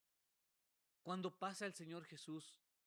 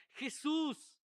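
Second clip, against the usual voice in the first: loud, high imitated cry; high-pitched, forceful yell; retold shout; 8 semitones higher, 10 dB louder than usual. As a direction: belt it out as the beggar's call. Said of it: "cuando grita".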